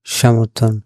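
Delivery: ordinary delivery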